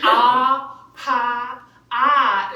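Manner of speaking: Boston "Ah Pop Art", slow, loud, r dropped; slow Boston accent. Retold or imitated.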